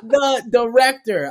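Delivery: high, loud, slow demand; mock outrage. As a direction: stretch it out loudly, mock furious. Re-enacts demanding the director.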